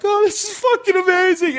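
high-pitched, tearful-happy whoop, acted out; mock crying-laughing elation. Retelling his relief while squeezing the lump, mock crying-laughing elation. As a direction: re-enact overwhelmed delight in a very high voice. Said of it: "I'm crying, laughing".

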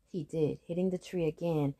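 ordinary delivery

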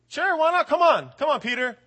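high, loud, breezy come on; cheerful invitation. Retold or imitated. Jesus inviting Peter onto the water, breezy. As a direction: wave him over brightly.

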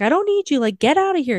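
high, sharp brush-off; mock snappy Daisy. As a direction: push him away, half-hearted. Daisy pushing him away.

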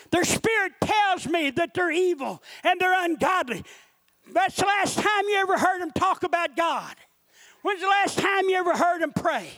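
high-pitched, driving sermon delivery; impassioned preacher in full flow; full-force preaching. Real, not acted. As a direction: preach it with rising urgency.

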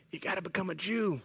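very soft, dropped-voice disclosure; hushed, careful acted reveal; delicate confiding. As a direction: break it to them quietly. Judaizer breaking news delicately.

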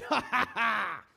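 slow, loud triumphant laugh; gloating laugh. Acted. Believer gloating that God is on their side, loud laugh. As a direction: laugh like you've won.

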